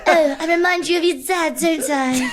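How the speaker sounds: high, laughing seductive tease; hammed-up femme fatale line. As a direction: purr it like a spy seductress. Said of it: "You're like some sort of femme fatale".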